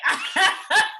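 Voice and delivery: loud giggling burst; high burst of laughter. Real, not acted. Laughing at show.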